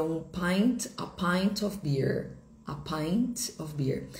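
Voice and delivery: slow, careful a pint of beer; slow teaching model. Shown as a demonstration. Teacher saying a pint of beer slowly for learners. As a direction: spell the phrase out slowly. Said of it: "teaching the phrase slowly".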